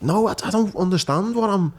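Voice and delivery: high-pitched upset protest, reliving the fight; pleading, voice up high and strained; retold outburst. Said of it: "And I'm like".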